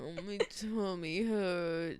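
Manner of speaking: slow, drawn-out plaintive moan; drawn-out complaint. Shown as a demonstration. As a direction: stretch the complaint out slowly. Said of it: "response to that".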